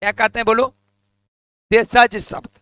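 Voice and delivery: usual speaking voice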